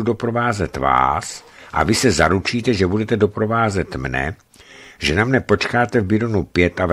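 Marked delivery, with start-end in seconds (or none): none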